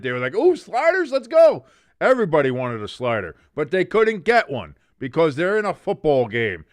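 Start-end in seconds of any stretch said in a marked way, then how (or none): 0.3-1.7 squealing high and loud with glee; mock the whole team getting hyped for sliders; excited teammates. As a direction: commentator voicing players thrilled about sliders, high excited squeal. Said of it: "all the other Eagles got excited".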